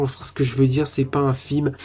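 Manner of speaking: normal talking voice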